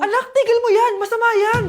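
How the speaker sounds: mother shouting at her daughter to stop sweeping; alarmed shout. Acted. Mother forbids sweeping at night.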